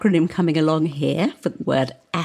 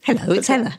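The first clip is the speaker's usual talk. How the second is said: friendly, higher-pitched phone hello; sweet, lifted greeting; grin in voice; 6 semitones higher, faster than usual. Shown as a demonstration. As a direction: answer with a little grin. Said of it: "I hear the little grin in your voice".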